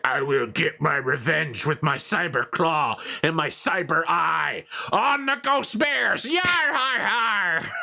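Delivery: high, slow, hammed-up villain vow ending in a pirate cry; over-the-top mock villain shout; Malavai Fletcher. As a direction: shout the revenge like a cartoon maniac. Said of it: "this guy was like".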